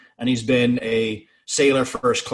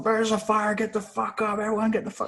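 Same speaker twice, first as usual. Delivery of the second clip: high-pitched frantic alarm, not shouted; mock panic; 11 semitones higher than usual. Sailor waking his whole mess, high mock-frantic warning. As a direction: imitate someone rousing everyone, voice way up. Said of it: "saying there's a fire".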